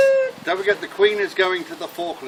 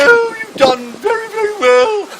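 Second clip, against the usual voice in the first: very high, loud mock-regal praise; regal Queen; 10 dB louder than usual. The Queen praises the wounded soldier, in a high loud regal voice. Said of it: "more regal".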